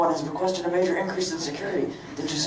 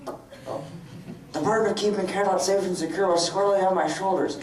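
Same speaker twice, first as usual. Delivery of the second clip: ordinary talk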